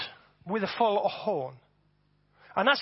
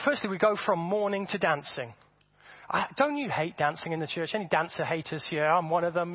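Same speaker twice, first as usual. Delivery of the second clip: ordinary delivery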